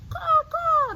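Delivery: high, loud crow cawing. Acted. Teacher showing kids how crows sound, high loud caw. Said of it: "The crows croaked".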